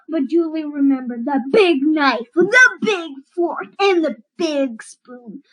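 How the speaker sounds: ordinary delivery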